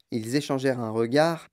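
ordinary delivery

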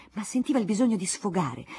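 ordinary delivery, nothing marked

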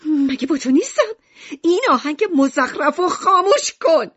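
high, loud angry shouting; furious scolding. A narrator's reading. Mother storming into Alan's room, furious. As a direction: yell the order, fed up. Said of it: "با عصبانیت به طبقهٔ بالا رفت".

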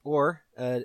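usual speaking voice

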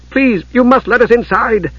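high-pitched urgent plea; pleading. A narrator's reading. Palin at the closing door, pleading. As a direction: beg to be let in.

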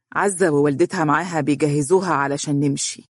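ordinary delivery, nothing marked

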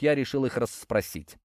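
plain reading voice

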